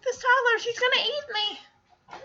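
high, small scared chick voice; terrified chick. Retold or imitated. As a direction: squeak it as a tiny chick eyeing a giant toddler.

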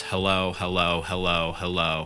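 low, slow repeated hellos; retold droning string of hellos; repetitive hello. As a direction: repeat it slowly on a loop, low. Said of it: "over and over again".

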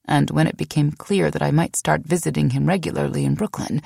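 ordinary delivery, nothing marked

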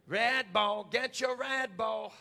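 drawn-out vendor's call; ballgame vendor. Acted. Preacher selling Red Bull to sleepy folks, vendor's singsong cry. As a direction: hawk it through the stands, stretching the words. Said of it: "like they do at ball games".

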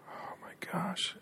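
whispered, slow Oh, my gosh; whispered shock. Real, not acted. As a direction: breathe it out in stunned horror. Reacts to abuse story.

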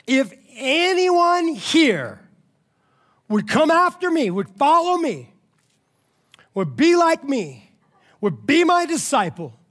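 high, slow, ringing proclamation; full-force preaching. Acted. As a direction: proclaim the verse phrase by phrase, with long pauses.

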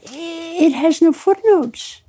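high, drawn-out retold wail; mock crying. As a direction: wail it slowly as if in tears. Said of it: "I'm crying".

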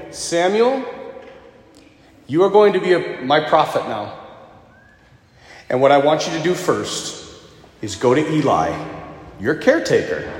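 grave voice of God. Acted. God's voice, slow grave.

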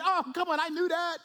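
high-pitched comic protest; mock exasperation. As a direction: play the paralytic, comically put out. Acts the exasperated paralyzed man.

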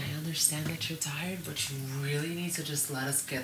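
soft, measured reasoning; calming parent. Retold or imitated. Mother talking a tired crying child down, soft and controlled.

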